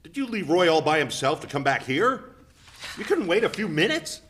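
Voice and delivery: husband scolding wife for leaving their son; reproach. Acted. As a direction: call her out.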